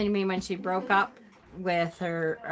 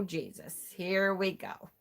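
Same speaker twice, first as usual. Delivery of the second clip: soft, resigned mutter; quiet, sinking here-we-go exasperation; weary dread; 3 semitones lower, 10 dB quieter than usual. Retold or imitated. Daughter reading mother's latest guilt text, weary dread.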